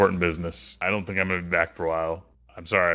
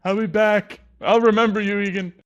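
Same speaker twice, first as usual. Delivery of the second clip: mock tearful farewell; 14 semitones higher, 6 dB louder than usual. Mock tearful farewell after single tear.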